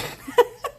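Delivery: sudden high burst of laughter. Real, not acted. Laughing when the app is still recording. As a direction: caught off guard, bursting out laughing.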